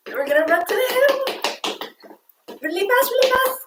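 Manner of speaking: put-on rally the kids to sprint for the hill; excited urging. Coach urging kids to run.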